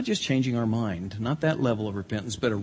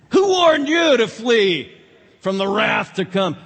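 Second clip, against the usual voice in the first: fiery rebuke; 9 semitones higher, 9 dB louder than usual. Retold or imitated. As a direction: thunder the warning at them. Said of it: "He had a real way with words".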